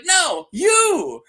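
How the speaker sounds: high, loud playful correction; hammed-up pointed exclamation; mock insistent shout. Host as queen singling out her knight, mock insistent.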